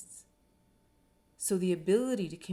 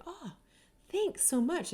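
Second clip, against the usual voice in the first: higher, brighter thank-you, leaning in; grateful delight; 10 semitones higher than usual. Retold or imitated. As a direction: gush thanks like help just landed.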